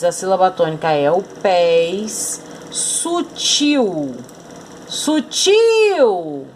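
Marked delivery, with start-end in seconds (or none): 2.7-4.2 "Sutil" lightly called, last syllable drawn out; calling the word. Shown as a demonstration. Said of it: "chame a palavra". 4.9-6.5 "Sutil" called high, long "-til"; calling the word. Shown as a demonstration. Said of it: "chame a palavra".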